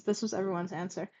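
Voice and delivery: plain reading voice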